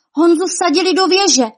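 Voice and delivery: high, loud cry of bad news; alarmed cry. A narrator's reading. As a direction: cry out the alarm, pitched well up. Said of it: "vykřikla".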